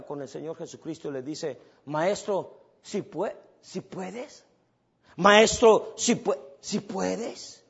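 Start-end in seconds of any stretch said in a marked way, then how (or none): 1.9-7.6 pleading father. Acted. Desperate father begging Jesus.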